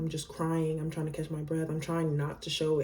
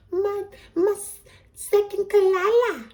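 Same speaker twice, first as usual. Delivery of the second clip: very high, slow little-girl impression; cute little girl; 9 dB louder than usual. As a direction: squeal it out loudly like an adorable child. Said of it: "she was just like".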